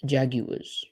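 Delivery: Jaguars modelled as "JAG-yoo-uhz"; Jaguars, British "JAG-you-uhz"; British pronunciation. Shown as a demonstration. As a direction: demonstrate Jaguars in three syllables, no final R. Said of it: "should probably pronounce it like that".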